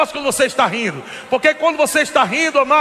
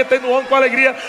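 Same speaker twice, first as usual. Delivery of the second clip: usual speaking voice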